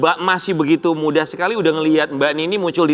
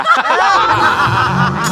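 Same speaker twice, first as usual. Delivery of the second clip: loud, high-pitched burst of laughter; amused cackle, high; real laugh; 7 semitones higher, 4 dB louder than usual. Real, not acted. Studio laughs at the health joke.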